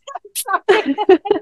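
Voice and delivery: high-pitched real laugh; burst of laughter. Real, not acted. After admitting she went and had sex, laughing. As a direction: crack up at your own answer. Laughs at own frank answer.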